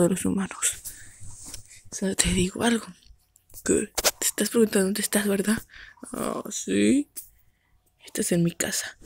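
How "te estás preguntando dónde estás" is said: whisper. Acted. Whispered voice held.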